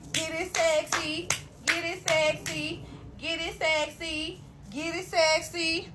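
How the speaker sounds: high, slow, egging-on chant; mock the friend cheering him on, drawn out; imitating hype friend. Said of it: "his homeboy was".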